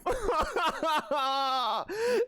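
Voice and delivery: a long, high, helpless laugh; embarrassed high laugh. Real, not acted. Embarrassed streamer laughing at her own story. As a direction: laugh it off, mortified.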